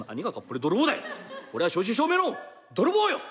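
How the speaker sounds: 何がかっぽれ泥棒だよ shouted back high and loud, laughing; indignant shout. Acted. Thief shouts at the mistress, offended, 何がかっぽれ泥棒だよ half laughing. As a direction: thief insists loudly that he's a real thief. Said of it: "静かになさいよ。".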